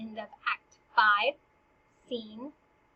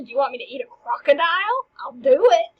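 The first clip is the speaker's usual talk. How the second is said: mock-defiant outburst; 3 semitones higher, 10 dB louder than usual. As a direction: escalate into an over-the-top challenge. Hamlet's boasts build to a climax.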